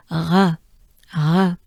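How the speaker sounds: "R" as a French throat r, tongue down; French R demo. Shown as a demonstration. Teacher demonstrating tongue position, throaty French R. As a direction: breathe "R" out from the back of the throat. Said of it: "just breathe and keep your tongue down".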